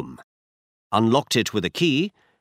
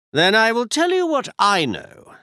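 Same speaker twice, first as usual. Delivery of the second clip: brisk, higher-pitched detective voice; Holmes voiced higher; 11 semitones higher, 4 dB louder than usual. A narrator's reading. Holmes tells Watson where they go, higher detective voice. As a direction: voice Holmes up high, assured.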